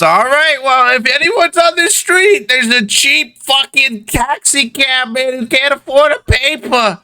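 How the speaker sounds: put-on high-pitched kid announcing to the whole street; mock the cabbie in a piping newsboy voice; jeering paperboy child. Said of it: "He goes".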